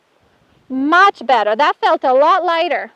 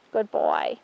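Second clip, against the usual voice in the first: soft praise; 8 dB quieter than usual. Real, not acted. Trainer softly praising her horse, low and soft. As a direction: murmur it gently to the horse.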